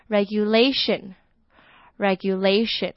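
regulation with the LA syllable stressed, said slowly. Shown as a demonstration. Teacher shows learners the stress in regulation, slowly. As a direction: lean on the la in regulation. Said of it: "重音就是在L A的地方".

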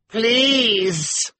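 very high, loud drawn-out yell; shouting. A narrator's reading. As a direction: shout it while struggling to get up. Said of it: "Gunter shouted again".

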